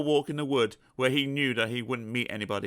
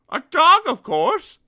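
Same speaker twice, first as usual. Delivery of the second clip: much higher, loud, pleased; triumphant Mister Dizzy; 12 dB louder, slower than usual. Acted. Mister Dizzy answering the elephant, triumphant. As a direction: toss off the easy answer.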